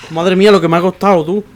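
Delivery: relieved outburst after a struggle; loud relief. Real, not acted. Relief after hard section.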